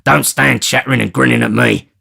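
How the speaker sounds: loud scolding; irritated robber; impatient. Acted. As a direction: bark at him.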